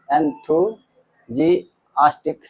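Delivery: enthusiastic stretched out very slowly, each syllable drawn long; slow pronunciation demo. Shown as a demonstration. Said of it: "enthusiastic भी बोल सकते हैं". (enthusiastic बहुत धीरे, हर अक्षर खींचकर)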